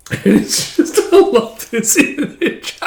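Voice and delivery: real high-pitched, breathless laughter through the words; can barely speak for laughing; laughing while talking. Real, not acted. Reader loving this image, laughing hard.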